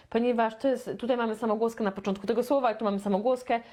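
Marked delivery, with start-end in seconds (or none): none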